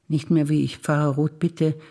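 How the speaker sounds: usual speaking voice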